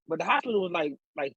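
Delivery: usual speaking voice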